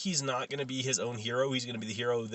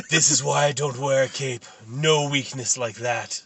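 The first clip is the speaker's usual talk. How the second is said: loud, terrible wrestler impression; The Rock; 6 dB louder than usual. Villain mocking a cape after winning, hammed-up Rock voice. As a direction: badly imitate a boastful wrestler, slowing at the end. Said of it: "that's a terrible Rock impression".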